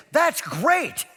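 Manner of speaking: high, loud burst of enthusiasm; retold gleeful exclamation; mock delighted. Youth pastor answers distraught mother's bad news, exaggerated delight.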